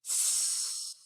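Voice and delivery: soft, long airy hiss; snake hiss. Acted. Snake hiding behind a rock, soft long hiss.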